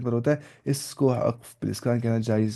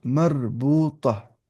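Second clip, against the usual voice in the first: long-drawn syllables for learners; slow, measured model pronunciation; slow dictation; much slower than usual. Shown as a demonstration. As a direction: stretch each syllable out slowly. Teacher dictates the term slowly.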